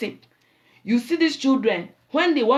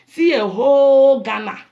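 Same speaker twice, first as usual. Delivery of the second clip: louder, higher burst on "see a whole Ghana"; emphatic outburst; 4 semitones higher, 9 dB louder than usual. Real, not acted. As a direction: throw it out to the crowd.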